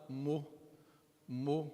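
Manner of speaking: "mu" with lips rounded forward; soft rounded "mu"; mu syllable. Shown as a demonstration. Teacher demonstrating the dhamma on meem. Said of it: "position should your mouth be".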